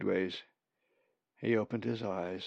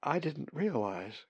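small, higher voice of regret; apologetic; 5 semitones higher, 4 dB quieter than usual. A narrator's reading. Molly apologizes to the Maine raven, meekly. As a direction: offer it as a meek excuse. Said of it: "Molly apologized".

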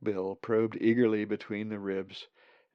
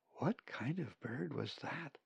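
slow, hushed, higher question; quiet; 5 semitones higher, 8 dB quieter, slower than usual. A narrator's reading. Colin asks Zack privately, quiet. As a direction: ask it softly so others can't hear. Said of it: "Colin asked Zack quietly".

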